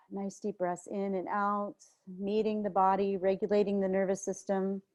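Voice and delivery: quiet calming breath cue; guided meditation. Acted. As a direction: hold them gently while they breathe. Soft meditation guidance.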